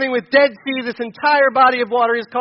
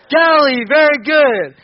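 excited praise; 4 semitones higher, 7 dB louder, slower than usual. Real, not acted. Class answer with teacher praise.

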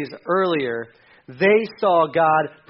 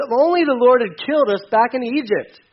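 higher, louder mock complaint; moaning complaint; 6 semitones higher, 4 dB louder than usual. A narrator's reading. Preacher reading Israel's complaint to Moses, moaning. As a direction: read it as an overblown grumble. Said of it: "they moaned".

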